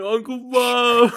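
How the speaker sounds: high, slow, pleading whine; pleading kid. Retold or imitated. Nephew pleading with his uncle after the mess, slow whine. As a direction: drag the name out like a sorry kid. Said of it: "And I'm like".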